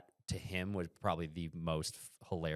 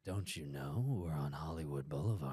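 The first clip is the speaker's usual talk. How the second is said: unvoiced, whispered mock menace; ominous driver; 3 semitones lower than usual. Driver turning to trapped tourists, ominous whisper. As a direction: whisper it like a horror-film reveal.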